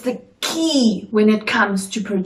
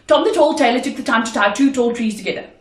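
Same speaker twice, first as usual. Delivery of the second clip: very fast, even articulation drill; fast tongue twister; much faster than usual. Shown as a demonstration. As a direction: race through the tongue twister in one breath. Tongue twister, fastest take.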